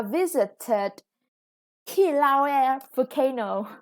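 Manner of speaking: Kilauea volcano spelled out slowly, each syllable of Kilauea clear; slow down and sound out Kilauea; careful pronunciation. Shown as a demonstration. Teacher slowly sounds out the Hawaiian name Kilauea for pupils. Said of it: "quite difficult to pronounce".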